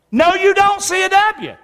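high, emphatic preacher's correction. Real, not acted. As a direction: set them straight, pitched right up.

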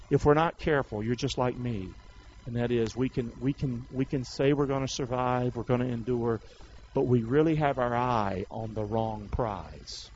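normal talking voice

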